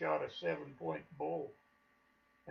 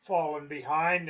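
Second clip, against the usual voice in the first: usual speaking voice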